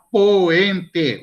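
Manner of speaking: "poente" split into po-en-te; vowels separated. Shown as a demonstration. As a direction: pull o and e apart in "poente". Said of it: "duas vogais separadas". ("poente" separando as vogais, po-en-te)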